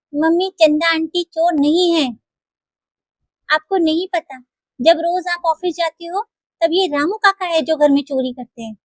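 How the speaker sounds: put-on high, soft little girl explaining; a young daughter gently telling her mother the truth; child voice.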